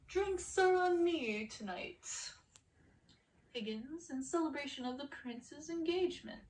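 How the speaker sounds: loud, high, slow bragging call across the bar; boastful Gaston toast. Acted. Gaston announcing drinks to the whole tavern, loud and boastful. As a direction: play the swaggering hunter buying the round, booming.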